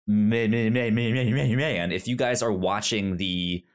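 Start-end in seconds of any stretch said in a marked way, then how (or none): none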